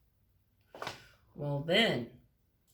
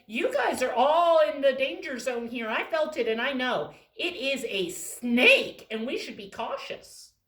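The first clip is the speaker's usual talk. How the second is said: loud, high cautionary warning; loud serious mouse; 4 semitones higher, 12 dB louder than usual. Acted. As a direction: warn the others of danger. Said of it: "the green that said".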